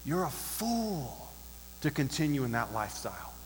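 lightly voiced grave pronouncement, stretching the key word; soft, weighty quote, letting it sink in; Solomon's verdict. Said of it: "Solomon says".

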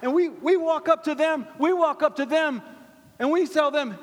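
high, loud sermon delivery; impassioned preaching. Real, not acted. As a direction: preach it out to the whole room. Preaching at full force.

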